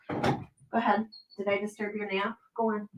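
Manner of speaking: quiet murmur to the cat; soft aside to cat. Real, not acted. Talking softly to her cat, quiet and coaxing. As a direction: coax the cat out gently.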